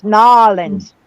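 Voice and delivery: "Nolens" as NAH-lenz, two syllables; Louisiana pronunciation. Shown as a demonstration. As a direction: say "Nolens" the local way, clipped. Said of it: "I about got the accent".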